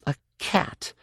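soft, breathy sour mutter. Acted. Kay seeing the kitten, sour mutter. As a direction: spit it out under your breath. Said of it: "muttered Kafira Kay sourly".

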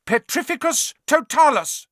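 high, loud incantation; casting cry. A narrator's reading. Hermione casting the spell at Neville, loud and high. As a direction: fling the spell out. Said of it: "she cried".

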